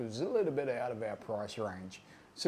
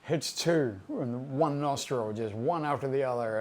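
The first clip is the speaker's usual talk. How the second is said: ordinary talk, nothing marked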